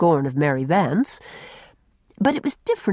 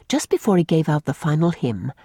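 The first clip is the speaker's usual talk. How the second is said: usual speaking voice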